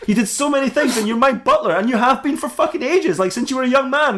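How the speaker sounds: high-pitched, exasperated amazement; mock-incredulous ranting. Batman acted, challenging his old butler.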